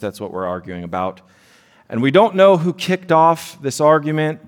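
usual speaking voice

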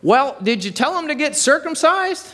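high, pointed voice of a busybody; shrill-pitched know-it-all question; nosy heckler. Acted. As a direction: pipe up high from the back of the room. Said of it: "and he's like".

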